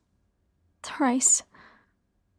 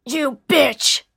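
loud, furious outburst from the killer; enraged; 3 semitones higher, 6 dB louder than usual. A narrator's reading. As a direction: spit it out in sudden rage. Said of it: "in his rage".

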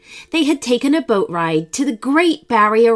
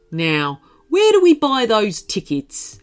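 kangaroo voice. Acted. Kangaroo character voice.

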